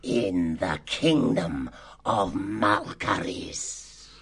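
slow, portentous villain pronouncement; name the dreaded place slowly, for effect; drawn-out menacing answer; ominous reveal. Acted.

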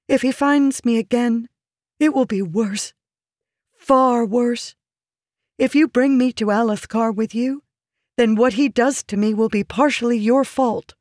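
high, urgent, fearful woman's voice; pleading woman, intense. Acted. Woman warning her protector of the king's rage, intensely pleading. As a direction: press the fear home in a high voice.

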